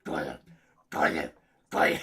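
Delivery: low gurgling Gollum gulps; Gollum throat gulp. Pretend. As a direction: croak the swallowing noise. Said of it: "you're like Gollum".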